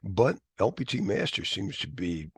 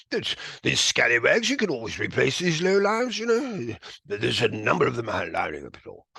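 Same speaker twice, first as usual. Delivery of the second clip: put-on British accent; 3 semitones higher than usual. Company boss dismissing ship crews, in a mock British accent. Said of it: "why the Dutch East India Tea Company would have a British accent".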